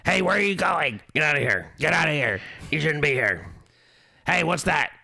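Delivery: mocking the Beetlejuice-style haunt voice; fake Beetlejuice scare actor. Shown as a demonstration. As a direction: heckle guests like a cheesy scare actor. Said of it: "One of them's".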